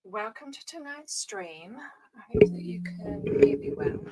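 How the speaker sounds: husky, half-whispered voice; hoarse, barely voiced; frog in throat. Real, not acted. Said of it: "my froggy throat".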